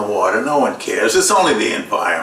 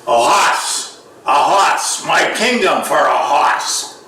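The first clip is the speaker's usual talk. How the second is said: higher-pitched hammy Richard the Third in Bostonian; horrible Boston accent; 5 semitones higher, slower than usual. Acted. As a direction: cry out Shakespeare with a Boston accent. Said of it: "you got that horrible Boston accent".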